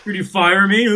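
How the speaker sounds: mock defiance, loud and high; taunting the boss, laughing.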